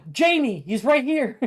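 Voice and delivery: very high, loud thrilled shout; gleeful reveal of the lookalike; excited. Acted. Excited discovery.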